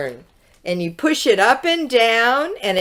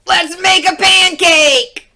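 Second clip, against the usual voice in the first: high, loud excited shout; eager shout; 10 semitones higher, 6 dB louder than usual. A narrator's reading. Jack begging his mother for a pancake, shouting. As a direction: belt it out like an impatient boy. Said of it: "shouted Jack".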